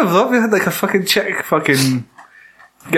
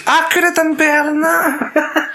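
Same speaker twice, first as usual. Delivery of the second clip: high, slow, laughing mock boast; 13 semitones higher, slower than usual. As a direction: brag through a laugh. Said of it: "It's like".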